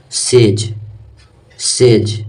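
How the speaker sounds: "सेज" as a separate model word, said twice; careful isolated "सेज" with pauses; palatal S demonstration. Shown as a demonstration. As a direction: open "सेज" on the palatal sibilant. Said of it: "स को pronounce करते हैं तालाबी स".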